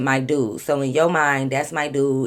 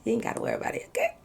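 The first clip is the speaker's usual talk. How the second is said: whisper; 7 semitones higher, 9 dB quieter, much faster than usual. Retold or imitated. Hushed aside to Eddie.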